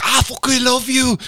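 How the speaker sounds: high, laughing Geordie impression; mock Geordie accent. Imitating the rapper's bear-hug reply in Geordie. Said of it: "That's Geordie".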